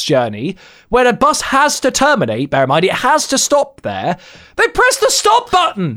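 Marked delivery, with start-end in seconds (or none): 4.6-6.0 high, frustrated near-yell; exasperated shout. Real, not acted. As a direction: blurt it out fed up. Angry rant about bus.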